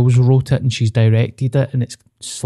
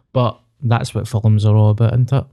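usual speaking voice

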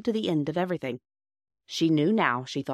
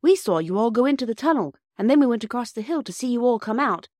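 put-on high, loud, emphatic boy; Peter; 7 semitones higher, 6 dB louder than usual. A narrator's reading. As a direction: explain the adventure eagerly. Narrator voices Peter explaining.

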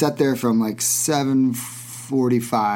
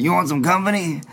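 higher, lightly put-on old roadie voice; a hint of old-timer, pitched up; 6 semitones higher than usual. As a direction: imitate an eccentric old hippie inviting himself along. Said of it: "he talks like this".

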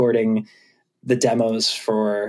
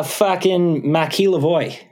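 slow, higher-pitched Boston accent; 5 semitones higher, slower than usual. Shown as a demonstration. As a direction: say it like a Bostonian, drawn out. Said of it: "say that in a Boston accent".

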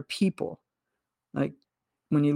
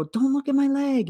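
urgent cry; 8 semitones higher than usual. Retold or imitated. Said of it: "and I go".

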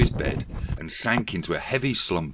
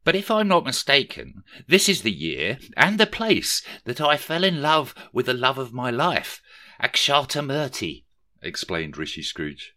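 higher, lightly put-on eager Rishi; lightly voiced Rishi; 6 semitones higher, 3 dB louder than usual. A narrator's reading. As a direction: gush about the rich heiress, a touch voiced. Rishi gushes about his love.

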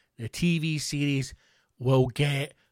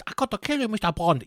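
put-on Chinese villain accent; 6 semitones higher, faster than usual. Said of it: "you're scared to do his voice".

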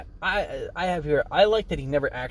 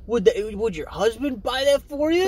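wrestler taunt; 10 semitones higher, 4 dB louder than usual. Retold or imitated. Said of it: "He's like".

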